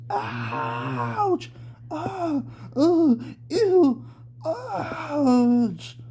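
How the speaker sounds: high crying ouch, breaking into cries; pained screams. Acted. Jomi screams at Samuel's bite, high and crying. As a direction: scream through the pain of the bite. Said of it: "she screamed in such pain".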